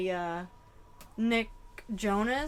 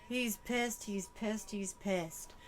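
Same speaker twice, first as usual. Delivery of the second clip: softer and softer repeated under breath; muttered dread; 7 dB quieter, slower than usual. Real, not acted. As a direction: fade it to a fearful hush. Sensing his anger.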